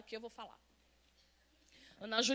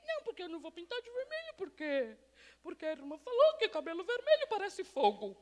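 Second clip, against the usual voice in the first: high-pitched imitation of a fussy churchwoman; exaggerated, silly protest; mock fretful excuse; 5 semitones higher than usual. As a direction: whine the excuse in a put-on high voice. Mimics a fretful woman's silly excuse.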